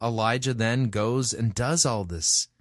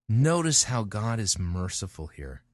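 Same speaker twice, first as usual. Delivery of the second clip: ordinary talk, nothing marked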